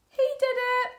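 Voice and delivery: parrot squawk. A narrator's reading. Parrot refrain repeated.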